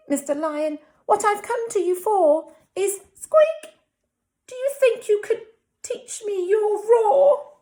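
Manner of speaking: high, squeaky, timid mouse voice; very high mousy pleading; nervous mouse. Acted. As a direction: squeak up bravely to the lion. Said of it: "Pardon me".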